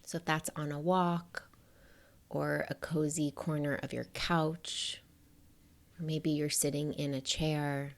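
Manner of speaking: soft, unhurried meditation voice with long pauses; hushed, spacious guidance, letting each phrase rest; guided meditation. Acted. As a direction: gently guide the listener to settle in.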